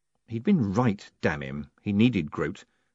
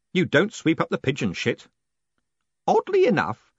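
plain reading voice

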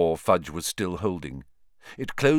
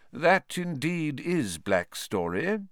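put-on calm old wizard; 6 semitones higher, slower than usual. Dumbledore measured reply.

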